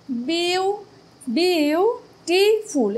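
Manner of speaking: slow, syllable by syllable: বিউটিফুল; syllables spelt out. Shown as a demonstration. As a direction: stretch বিউটিফুল out beat by beat. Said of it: "এখানে কটা syllable রয়েছে?".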